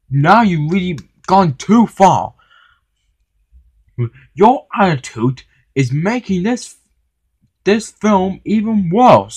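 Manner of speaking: high, heated telling-off; indignant scolding. A narrator's reading. Henry tells the manager he has gone too far, indignant.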